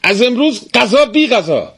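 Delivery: high, hard threat; threatening. A narrator's reading. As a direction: lay down the law, menacing. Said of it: "تهدید کنان اضافه کرد".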